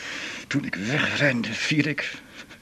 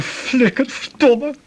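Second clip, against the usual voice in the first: sudden high, loud bitter cry; a cry of hurt anger; angry outburst; 10 semitones higher, 4 dB louder, slower than usual. Acted. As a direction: spit it out, stung and furious. Bitter outburst about the insult.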